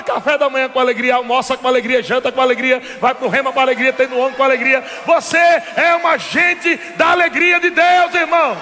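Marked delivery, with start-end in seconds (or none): 5.1-8.5 slow, higher, ringing preacher's cry; preaching climax. Acted. Preacher hailing his brother in the pews, ringing out. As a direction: lean into it, drawn out.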